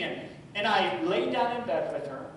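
usual speaking voice

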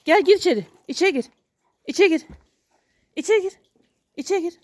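high, repeated order to a pet; commanding the dog. Real, not acted. Owner sends his dog inside, high insistent commands.

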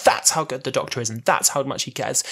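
ordinary talk, nothing marked

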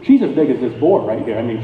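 ordinary talk, nothing marked

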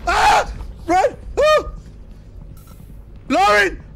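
loud high panicked screaming; real terrified screams. Real, not acted. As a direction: shriek and yell her name. Chased by monster, screaming.

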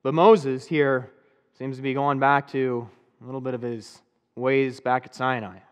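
usual speaking voice

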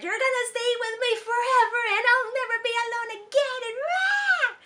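high, screechy villain declaration ending in a roar; possessive proclamation. Retold or imitated. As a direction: proclaim it like a clingy monster, building to a yell. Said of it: "Janet proclaims that".

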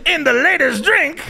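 high, exaggerated celebrity impression; hammed-up put-on voice, lecturing other men; Bill Cosby. Comic plays the entertainer as dating-show teacher, high mocking impression. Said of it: "he would teach other guys".

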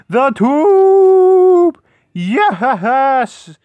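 high, loud cry of delight; excited shout. Real, not acted. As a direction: shout it out in triumph. Excited cry over the tube.

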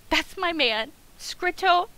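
ordinary delivery

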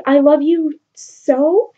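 slow, rising swoon; lovestruck plea. Retold or imitated. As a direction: sigh it out like a smitten boy. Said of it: "he's like".

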